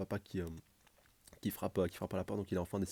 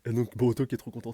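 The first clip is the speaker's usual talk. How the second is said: ordinary talk, nothing marked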